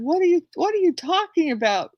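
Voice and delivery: high-pitched, incredulous questioning; exasperated bafflement. Retold or imitated. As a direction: retell a baffled comeback, stumbling into it. Retold baffled reply about pancakes.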